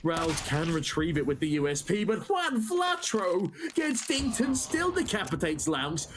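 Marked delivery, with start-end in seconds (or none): 2.0-6.1 high, slow, drawn-out hype; excited caster call. Real, not acted. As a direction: stretch the call out in awe. Live play-by-play climax.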